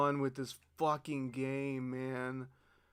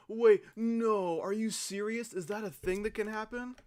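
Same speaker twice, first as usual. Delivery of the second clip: high, louder sudden alarm; startled realization; 7 semitones higher, 4 dB louder than usual. Real, not acted. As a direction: jolt of real surprise. Discovers the cause on replay.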